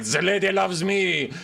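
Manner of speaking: high, loud, slow, proud; noble knight. Retold or imitated. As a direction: declare it like a blessed champion. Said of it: "he'd be like".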